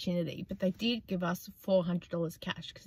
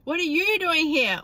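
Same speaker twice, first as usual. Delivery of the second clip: high, louder mock-surprised question; imitating the friend; 8 semitones higher, 7 dB louder than usual. Replaying the friend's surprised question, high and loud. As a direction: bounce it up like her friend did.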